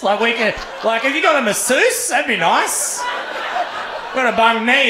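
high, laughing disbelief at the request; laughing mock rant. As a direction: rant through laughter, pitched high and incredulous.

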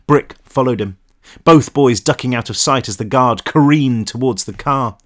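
usual speaking voice

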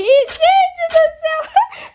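high, slow startled cry; startled shout. Real, not acted. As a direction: shriek it out in shock. Startled after dying in game.

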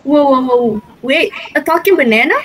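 loud, startled disbelief; surprised. Acted. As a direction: shout it out in shock. Friend hears about talking banana.

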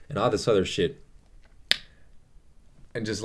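normal talking voice

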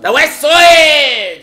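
loud, high, drawn-out shout; rallying shout. Pretend. Answering co-host who asks which side, shouting. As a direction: bellow it slowly like a proud chant.

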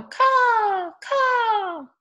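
high, loud, long caws; crow caws. Acted. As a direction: caw like a crow for little children. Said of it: "And they go".

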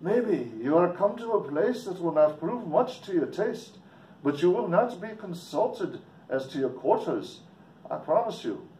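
high, lilting mock Irish brogue; curt stranger in an amateur Irish accent. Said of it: "Sorry about my English or Irish accent".

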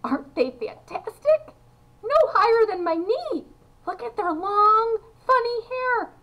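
high, loud wonder; excited marvelling. A narrator's reading. Children and parents marvelling at the tiny men, high with excitement. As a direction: exclaim over each astonishing detail.

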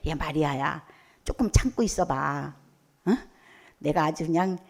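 a calm reassuring friend saying wait a little; tender comforting. Retold or imitated. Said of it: "그러면은 마리아에게".

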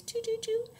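very high little toots; playful toots. Shown as a demonstration. Reader adding sounds to the rhyme, high toots. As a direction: toot it like a tiny horn.

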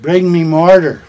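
mock shout. Mock demand, punchline.

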